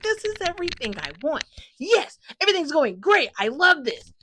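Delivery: high-pitched fangirl delight; overjoyed gushing. Retold or imitated. Fan reacting to the couple's confession, high and overjoyed. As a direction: squeal it out, thrilled.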